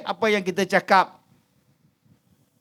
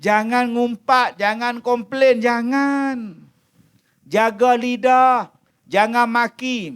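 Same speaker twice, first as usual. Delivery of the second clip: ordinary talk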